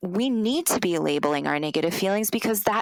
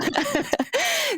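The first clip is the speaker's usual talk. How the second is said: short laugh. Real, not acted. Laughs at herself.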